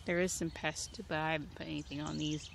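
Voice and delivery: ordinary talk, nothing marked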